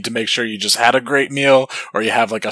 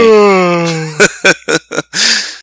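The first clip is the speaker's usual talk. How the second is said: big fake yawn; faking tiredness; 8 semitones higher, 7 dB louder than usual. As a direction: yawn it out loud, hammed up. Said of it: "after a fake yawn".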